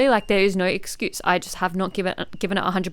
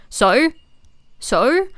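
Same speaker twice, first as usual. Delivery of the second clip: dismissive so; 5 semitones higher, 5 dB louder than usual. Real, not acted. Dismissing a food excuse.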